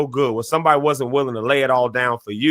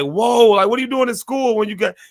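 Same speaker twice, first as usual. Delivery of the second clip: incredulous question; 10 semitones higher than usual. Retold or imitated. Friends question him coming to school.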